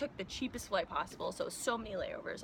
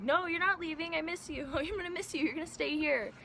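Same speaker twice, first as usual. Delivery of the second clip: high pleading mock friend voice; friends begging her to stay; 7 semitones higher than usual. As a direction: whine like a clingy friend who won't let go. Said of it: "those friends that are like".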